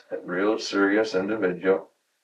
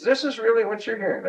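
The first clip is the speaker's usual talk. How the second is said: much higher, wide-eyed disbelief; incredulous question; 13 semitones higher than usual. Retold or imitated. Boss double-checking the rumor about his promotion, incredulous. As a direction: ask it like it's too good to be true.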